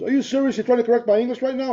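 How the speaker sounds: imitating an indignant caller, pitched high; high-voiced mock outrage; retold indignation. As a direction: act out an offended, incredulous challenge.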